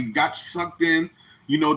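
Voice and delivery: ordinary talk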